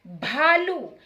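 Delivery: भालू with a breathy bh; careful भालू with aspirated bh. Shown as a demonstration. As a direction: push air after the b in भालू. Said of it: "ma'am भा कह रही हैं".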